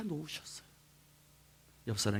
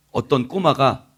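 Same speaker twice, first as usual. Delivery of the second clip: ordinary talk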